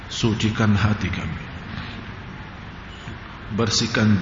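low, measured supplication with long pauses; prayer. Real, not acted. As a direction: lead the congregation gently in prayer. Leads a closing prayer.

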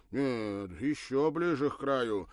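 loud demanding order. Acted. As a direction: insist he step nearer. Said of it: "потребовал тот".

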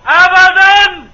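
loud, slow, drawn-out "أبدًا"; exploding shout. Acted. Husband losing patience, shouting back slow and loud. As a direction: finally blow up and stretch the word.

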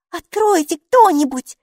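very high, slow, loud plea; pleading little girl. A narrator's reading. Maiki begs someone to answer the door, high pleading. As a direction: beg in a small child's high voice. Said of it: "взмолилась Майки".

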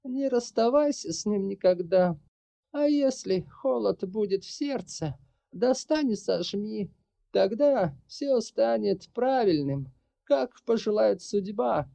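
slow, pained exhale of words. A narrator's reading. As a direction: let the pain sound through, slowly. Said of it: "С болью в голосе выдохнула".

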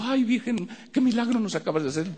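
high, mocking wail; mock family praise. (imitación burlona, aguda)

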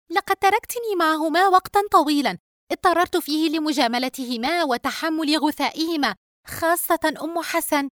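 high-pitched daughter, complaining; aggrieved younger daughter. Acted. Daughter complaining she was left with guests, aggrieved. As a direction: gripe to mother in a high voice.

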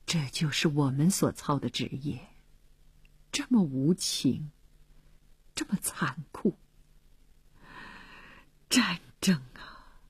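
soft, low, sighing thoughts; sorrowful musing. A narrator's reading. Philip reflecting on his cruel profession, sorrowful and hushed. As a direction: sigh it out quietly, bitter and grieved. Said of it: "他觉得可笑而又可悲".

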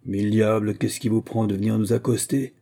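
plain reading voice

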